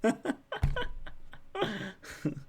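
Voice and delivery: high, soft drawn-out laugh; laughter. Real, not acted. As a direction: laugh helplessly for a couple of seconds. Laughs at the message just read.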